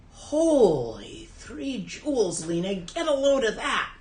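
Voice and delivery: slow, loud, wonderstruck cry; amazed exclamation. Retold or imitated. Teacher points at empty sky, marveling to his student. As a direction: gasp it out like he's seeing a miracle.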